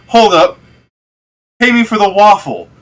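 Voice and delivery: loud, high call after him. Acted. Waffle seller shouts after the fleeing customer, loud and high. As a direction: shout him back to pay.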